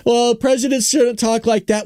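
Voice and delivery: high, slow mocking quote; mimicked complainers. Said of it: "are the same people that are saying".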